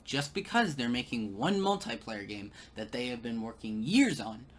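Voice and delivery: ordinary talk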